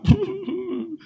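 high-pitched mock wail; mock crying. Preacher imitating the man who starts crying, mock wailing. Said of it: "This man starts crying".

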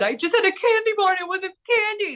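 high, rushed mock panic; retold hysteria. Retelling a late-night call for help, mock hysterical. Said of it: "I'm hysterical".

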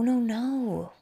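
normal talking voice

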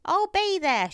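put-on high, loud old lady; June. A narrator's reading. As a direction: accept in a piping old woman's voice. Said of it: "she said".

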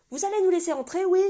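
loud high yell. A narrator's reading. Kenan yelling at the guard to open up. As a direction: yell it up at the gate. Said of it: "hurla Kenan".

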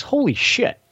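high mock astonishment; astonished. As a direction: play the clerk floored by the news. Employee learns who grandfather is.

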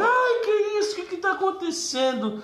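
startled mother, voice jumping up high; panicked mother. Retold or imitated. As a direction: play the frightened mom bursting in. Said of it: "minha mãe".

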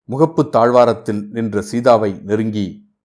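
ordinary delivery, nothing marked

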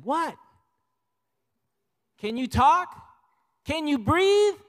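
high, loud, frantic questions; panicked. Retold or imitated. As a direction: fire the questions at the choking child. Grandfather panics, child choking.

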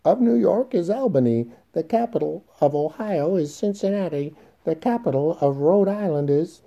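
put-on very high, loud childish recitation; piping, nasal child's sing-song; nasal child reciting. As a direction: recite capitals like a schoolboy on a recording. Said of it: "nasally, childishly, with the capital cities".

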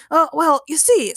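high, hesitant, lightly flustered; stammering. A narrator's reading. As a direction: stall awkwardly in character. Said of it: "stammered the proud man".

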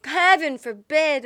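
loud, high, drawn-out mock dismay; hammed-up outrage; mock horror. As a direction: play it scandalised and slow. Teasing horror at sounding Canadian.

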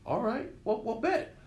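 quoting CK. Retold or imitated. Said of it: "He goes".

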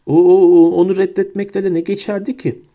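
high, stuttering, panicked burst; terrified stammer. A narrator's reading. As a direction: stumble over the words in panic. Pettigrew stammers in fear.

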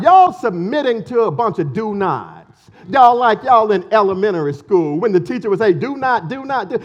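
loud mocking taunt; mock the false teachers, loud and scornful; mocking Paul. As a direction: sneer at them, loud and scornful. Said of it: "He's mocking them".

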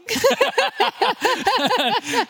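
high teary laugh breaking out; a sudden high laugh covering tears; high emotional laugh. Real, not acted. Emotional laugh about heartbreak.

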